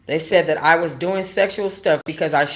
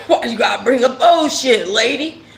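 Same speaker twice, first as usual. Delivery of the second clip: put-on higher and louder, laughing through "Why you gotta bring up old shit"; laughing retort; 7 semitones higher, 6 dB louder than usual. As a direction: laugh out the comeback at the judge. Laughing at judge's remark.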